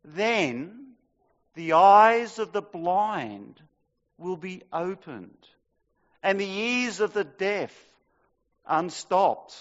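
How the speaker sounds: slow, paused, swelling proclamation; dramatic scripture reading. Acted. Preacher reading the prophecy of healing, dramatic. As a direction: build each phrase with pauses.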